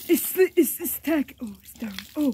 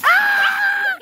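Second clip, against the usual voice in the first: high-pitched yelp; playful scream; 12 semitones higher, 4 dB louder than usual. Pretend. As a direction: let out a high, sustained yelp. Playful mock fight, sudden outcry.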